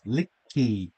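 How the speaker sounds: "Licky" repeated slowly with care; pronunciation demo. Shown as a demonstration. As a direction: drill the name "Licky" for a pupil.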